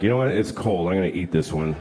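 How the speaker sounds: low, matter-of-fact animal parent; deadpan animal parent. Acted. Animal parent deciding about its newborn, deadpan. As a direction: play it low and unbothered.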